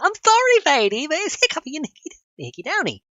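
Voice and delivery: silly singsong. Retold or imitated. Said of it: "I was like".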